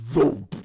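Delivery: loud, sudden thump; punched-out thud noise; imitated thump. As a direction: sound out a heavy impact. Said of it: "when they hear a".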